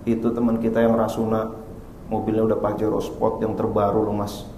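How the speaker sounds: hint of a nagging wife, quiet; mild wife voice. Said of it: "Istri berkata". (istri merayu pelan)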